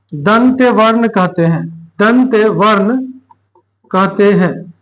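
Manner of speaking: usual speaking voice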